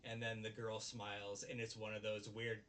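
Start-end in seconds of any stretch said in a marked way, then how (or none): none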